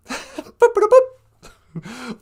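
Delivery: very high robotic boop boop; chirpy mock computer beeps. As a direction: beep like a little computer.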